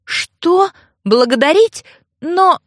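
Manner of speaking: high, incredulous questions; taken aback. A narrator's reading. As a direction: echo it in disbelief, then falter. Heroine recoils from his remark.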